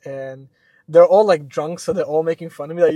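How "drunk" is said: ordinary talk, nothing marked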